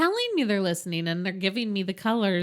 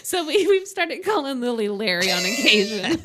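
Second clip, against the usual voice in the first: laughing; 7 semitones higher than usual. Real, not acted. Laughing while telling.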